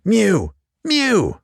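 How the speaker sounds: high plaintive mew; high loud meow; cat mewing. Acted. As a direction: cry out like a cat at the door. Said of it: "cried Simpkin".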